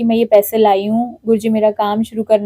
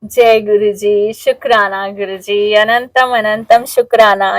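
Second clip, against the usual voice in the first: slow, hushed, grateful prayer; a slow, awed murmur of gratitude; reverent thanks; 4 semitones lower, slower than usual. Real, not acted. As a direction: breathe out thanks to Guruji, slowly. Overwhelmed thanks on opening gift.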